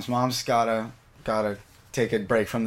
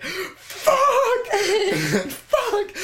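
very high laughing screams of pain; mock scream of pain. Retelling hitting her ankle, laughing high pained shrieks. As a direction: shriek it like you just smashed your ankle. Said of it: "screaming, I'm like".

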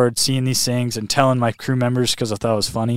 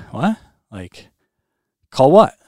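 stunned disbelief; 3 semitones higher, 5 dB louder, slower than usual. Retold or imitated. Retelling his dad's plan to stop, shocked and louder. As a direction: caught off guard, pushing back hard. Dad suggests calling off swim.

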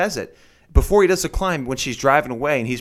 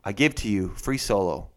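low, measured title announcement; mock-grand presentation; 7 semitones lower, 4 dB quieter than usual. Podcaster summing up film with mocking title, mock-grand. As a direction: announce it low, deadpan formal.

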